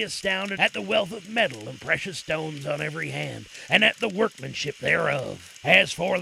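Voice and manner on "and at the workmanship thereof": ordinary delivery, nothing marked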